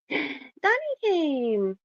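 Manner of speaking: gasping, high, drawn-out delight; light up as a friend arrives; slow high thrilled gasp; excited gasp. Real, not acted. Streamer's friend joins the stream.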